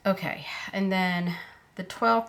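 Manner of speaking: usual speaking voice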